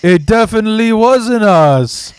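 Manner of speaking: hammed-up slow lie, obviously unconvincing; badly faked denial. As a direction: overact a guilty denial, dragging it out. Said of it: "your eyes going left and right".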